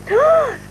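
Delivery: big high mock gasp of delight. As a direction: gasp as if thrilled by the chocolate. Chocolate bar offered to puppet.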